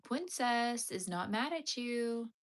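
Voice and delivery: ordinary talk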